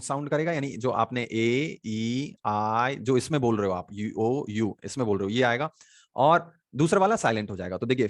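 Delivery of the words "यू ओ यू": usual speaking voice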